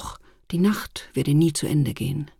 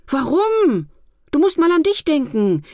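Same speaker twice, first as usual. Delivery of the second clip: higher, louder, eager coaxing; eager urging; 9 semitones higher, 6 dB louder than usual. Acted. Festive day coaxes weary Alltag to take a break, eager and lively. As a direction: push him on with bright enthusiasm.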